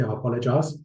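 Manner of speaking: usual speaking voice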